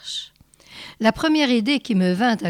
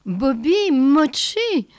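slow, higher call for the dog; 5 semitones higher, slower than usual. A narrator's reading. Lunella calls her dog by name, slow and raised. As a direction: call the dog's name across the flat. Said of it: "cria-t-elle".

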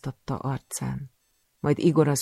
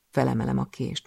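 usual speaking voice

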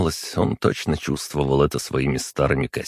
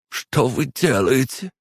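high, slow, indignant question; pitched-up, chilly reproach; icy tone; 9 semitones higher, slower than usual. A narrator's reading. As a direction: ask slowly in cold affront. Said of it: "тон Фламеля заледенел".

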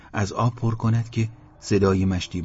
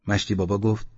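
ordinary delivery, nothing marked

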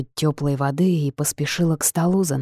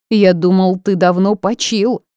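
put-on louder, slower, spiteful old ghost; angry retort; 3 semitones higher, 6 dB louder than usual. A narrator's reading. Ghost angrily greets the returned man, louder. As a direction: spit it at the man you resent. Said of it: "зло бросил Фентон".

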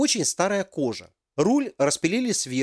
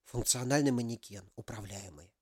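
hushed whisper, fading away; 4 semitones lower, 11 dB quieter than usual. A narrator's reading. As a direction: keep it a secret whisper. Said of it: "прошептал на ушко женщине".

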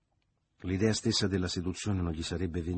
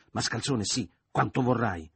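higher, louder dismissive retort; angry retort; 4 semitones higher, 6 dB louder than usual. A narrator's reading. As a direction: throw the insult back at him. Man throws the insult back. (replica rabbiosa, a voce alta)

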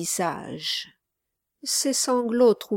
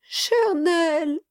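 put-on high-pitched little boy calling out; pleading child's cry to a dear friend; 9 semitones higher, 3 dB louder than usual. A narrator's reading. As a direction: exclaim in a small boy's high voice. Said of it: "s'écria-t-il".

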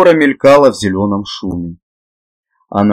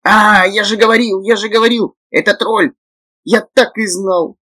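high squeaky gremlin voice; 12 semitones higher than usual. A narrator's reading. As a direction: squeak it out like a rubbery toy. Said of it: "пропищал Лопоухий".

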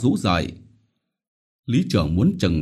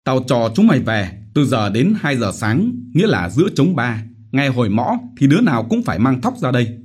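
self-righteous harangue, higher and louder; blustering village chief; 6 semitones higher, 4 dB louder, faster than usual. Acted. Chief justifying the beatings to villagers, blustering harangue. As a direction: lecture them with false concern. Chief's closing orders and threats.

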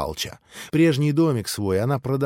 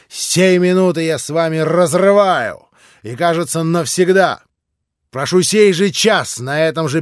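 furious break; 5 semitones higher, 8 dB louder than usual. A narrator's reading. Ivan breaks with Alyosha.

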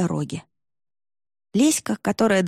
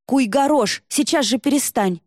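higher, louder urgent command; приказной окрик; 6 semitones higher, 4 dB louder than usual. A narrator's reading. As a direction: cry out to make him stop. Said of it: "крикнула Варя". (требовательный окрик)

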